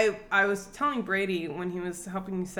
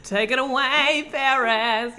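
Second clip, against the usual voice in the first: mock handover; 6 semitones higher, 7 dB louder, slower than usual. Hands over, mock announcer.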